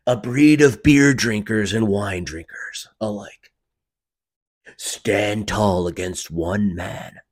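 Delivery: loud, slow, epic summer blockbuster narration; movie trailer voice. Acted. Trailer guy announces the podcaster film, in movie trailer voice. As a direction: boom it out with big dramatic pauses. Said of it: "The movie trailer voice?".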